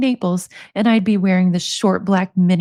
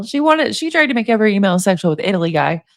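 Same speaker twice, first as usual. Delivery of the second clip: ordinary talk, nothing marked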